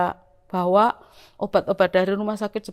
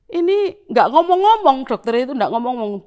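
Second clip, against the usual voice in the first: higher and louder, fed-up complaint; exasperated; 4 semitones higher, 5 dB louder than usual. Retold or imitated. Patient retelling the doctor's silence, exasperated and higher, louder. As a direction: vent the frustration at the silent doctor. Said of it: "Pingin rasanya gemes".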